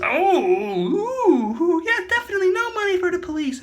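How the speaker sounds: high, fast mock official; high put-on voice. Storyteller voicing the politician agreeing. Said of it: "and then he goes".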